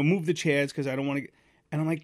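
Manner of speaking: usual speaking voice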